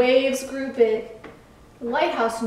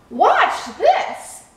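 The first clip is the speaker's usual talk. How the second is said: high, loud and eager; excited, punchy dare; showing off; 9 semitones higher, 5 dB louder than usual. A narrator's reading. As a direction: announce a prank with gleeful bravado. Boat about to show off.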